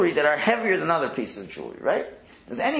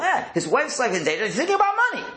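mocking critics; 8 semitones higher, faster than usual.

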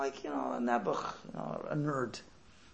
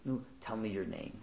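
gentle request; 4 semitones lower, 7 dB quieter than usual. Retold or imitated. Rabbi gently asks his name.

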